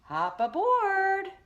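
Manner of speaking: high, loud and slow character call; Herbie calling. A narrator's reading. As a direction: stretch it out high, hailing her. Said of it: "shouted Herbie".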